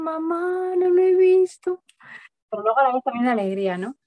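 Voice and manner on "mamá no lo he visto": high, slow tearful wail; imitating a crying daughter. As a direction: mimic a little girl bursting into tears. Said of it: "se puso a llorar".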